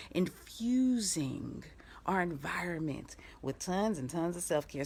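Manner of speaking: soft secret whisper. Acted. To her viewers, teasing self-care in a whisper. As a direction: breathe it like a secret.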